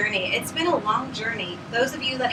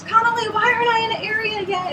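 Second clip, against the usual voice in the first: high whiny complaint; whiny past self; 12 semitones higher, 6 dB louder than usual. Retold or imitated. Complaining to her mentor Connolly, high and whiny. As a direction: whine it like an impatient younger you. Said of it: "I'd be like".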